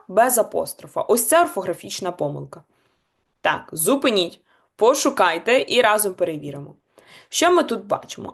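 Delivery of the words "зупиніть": ordinary delivery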